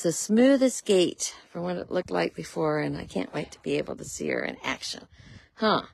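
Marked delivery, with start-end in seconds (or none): none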